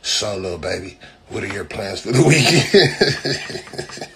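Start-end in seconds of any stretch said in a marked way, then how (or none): 1.3-4.1 slow mocking question broken by laughter; can't get through the mock quote for laughing. Mocking friend's weekend question while cracking up.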